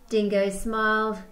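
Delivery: ordinary talk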